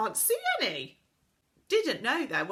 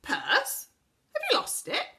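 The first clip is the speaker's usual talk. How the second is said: much higher, worried question; anxious; 13 semitones higher, 7 dB louder than usual. A narrator's reading. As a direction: fret about a lost purse. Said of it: "said the Saucepan Man anxiously".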